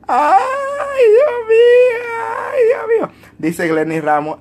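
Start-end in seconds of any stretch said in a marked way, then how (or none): 0.1-3.1 mock wail. Real, not acted. Laughing at own story.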